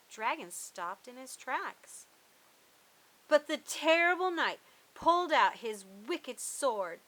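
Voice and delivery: ordinary delivery, nothing marked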